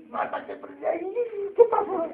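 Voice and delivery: high, slow, hammed-up blubbering; imitating a sobbing manager calling for his mother; mock crying wail. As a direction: wail for mom like a crybaby. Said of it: "Y empiezan a llorar".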